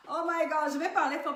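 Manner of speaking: speaking up. Real, not acted.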